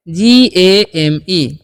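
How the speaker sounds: slow, letter by letter; spelling out letters. Shown as a demonstration. Teacher spells out game for students, slowly. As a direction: drag the letters out one by one. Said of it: "यहाँ पर लिखा हुआ है".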